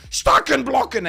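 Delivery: high, loud mock German shout. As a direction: bark it like a fake German game host. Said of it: "they're like".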